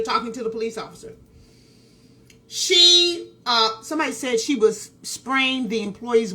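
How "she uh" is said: usual speaking voice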